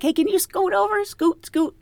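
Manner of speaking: high, playful mock call to scoot; teasing call. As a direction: tease them with a singsong scoot. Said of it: "we were like".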